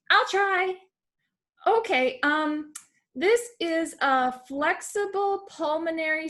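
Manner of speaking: high-voiced pupil reading a long fancy phrase; eager pupil. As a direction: pipe up like an excited kid showing off. Second pupil's turn.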